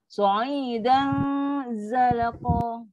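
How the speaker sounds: drawn-out "Sa'idan" released into a thin z; dengung before zai. Shown as a demonstration. Teacher shows hum before touching zai, held dengung. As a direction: hum out the end of "Sa'idan", then "zalaqa". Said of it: "Bunyi dengung baru kena pada zai".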